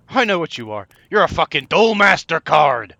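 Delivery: loud mock accusation. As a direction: shout it like exposing a fake. Accusing elephant in mock outrage.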